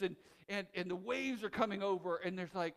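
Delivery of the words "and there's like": ordinary delivery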